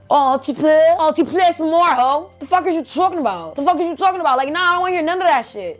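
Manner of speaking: high-pitched, indignant cursing out; mock tirade. Vlogger acting out cursing at the aunt, high indignant tirade. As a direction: rant high and incredulous, pushing every phrase.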